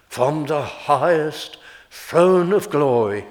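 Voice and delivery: preacher reaching for glory; preaching swell. Acted. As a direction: rise on the highest throne. Sermon climax.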